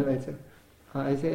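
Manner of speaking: ordinary talk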